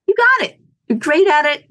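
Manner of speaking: high, loud mock-gracious surrender; conceding defeat. Owner ceding high school market to rival, high mock-gracious. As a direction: hand the market over with a flourish. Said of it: "I was like".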